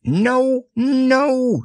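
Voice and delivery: high, fussy owl protest; flustered, squeaky no. Acted. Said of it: "getting quite fussy".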